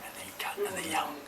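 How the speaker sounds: hushed, half-whispered aside; mock whisper. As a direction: confide it as if others might overhear.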